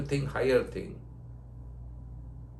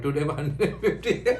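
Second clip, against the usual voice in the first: laughing. Real, not acted. Laughing at his own joke.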